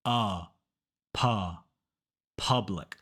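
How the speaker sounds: Uh, Pu, Public built up with pauses; unrounded "uh" in Public, falling; uh vowel drill. Shown as a demonstration. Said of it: "the U makes an uh sound".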